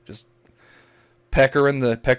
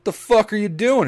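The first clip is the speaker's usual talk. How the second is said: sharp, much higher and louder yell at him; retold yell; 10 semitones higher, 5 dB louder than usual. Local shouting at a man by the river, loud yell. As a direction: yell in shock at a friend dropping his pants. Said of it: "one of the other locals yells".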